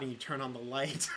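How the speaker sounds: ordinary talk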